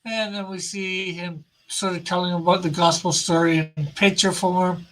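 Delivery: normal talking voice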